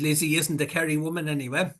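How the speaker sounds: ordinary talk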